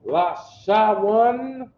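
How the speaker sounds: Lashawan drawn out slowly and loud, each syllable clear; slow demo pronunciation. Shown as a demonstration. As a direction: sound out Lashawan slowly for the class. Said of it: "Listen to what I'm saying".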